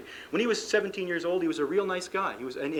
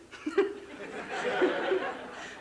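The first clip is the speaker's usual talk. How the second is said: breathy laugh; 14 semitones higher than usual. Real, not acted. Laughs at his own barbaric model.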